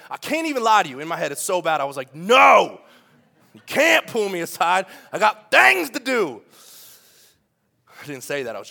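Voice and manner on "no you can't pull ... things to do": loud mock-frantic protest, rising at the end; exasperated outburst. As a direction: blurt it out loudly as a comic inner scream. Acted inner outburst at being interrupted.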